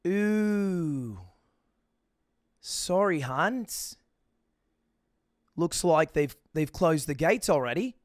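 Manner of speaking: drawn-out mock-apologetic Australian attendant; flight attendant. Airline lady tells late traveler, apologetic attendant voice. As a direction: imitate an airline worker breaking bad news. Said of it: "like flight attendants do".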